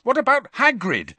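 Harry challenging Parvati, angry. Acted. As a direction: throw it back at her hotly. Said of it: "Harry said angrily".

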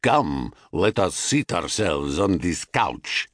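put-on low seducer in a Thracian accent; deep, forceful stranger with Thracian accent; thick Thracian accent. A narrator's reading. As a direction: put on a heavy Thracian accent, pitch dropped. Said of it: "in a thick Thracian accent".

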